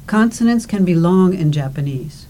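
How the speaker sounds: ordinary delivery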